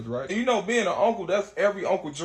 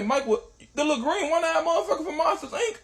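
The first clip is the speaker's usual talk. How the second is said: much higher, laughing-crying disbelief; 10 semitones higher than usual. Retold or imitated. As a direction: shriek out the name in shock, half laughing. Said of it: "I say".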